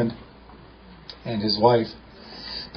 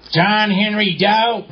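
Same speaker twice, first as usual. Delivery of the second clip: retold yell; 8 semitones higher, much slower than usual. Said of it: "she starts yelling out".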